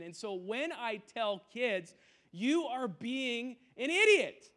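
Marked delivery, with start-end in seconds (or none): 2.3-4.5 slow, louder, higher scolding; stern slow scolding; harsh rebuke. Retold or imitated. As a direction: bark it like a fed-up youth pastor. Pastor acting harsh warning to kids.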